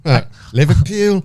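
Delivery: put-on Scouse accent. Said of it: "I think I'm all right at Scouse".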